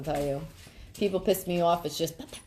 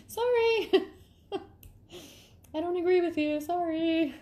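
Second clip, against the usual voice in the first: high, slow, giggling sorry; laughing apology; 11 semitones higher, slower than usual. Real, not acted. To viewers, apologising mid-giggle, laughing high. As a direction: apologise while cracking up.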